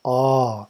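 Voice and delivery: a long, held open "ah." sound; prolonged ah. Shown as a demonstration. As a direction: draw out "ah." like the first syllable of Om. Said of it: "but prolonged".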